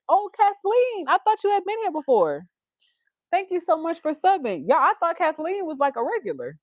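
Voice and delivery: high, excited surprise at a new subscriber; surprised delight. Real, not acted. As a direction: gush with real surprise and thanks. Viewer turns out to be new.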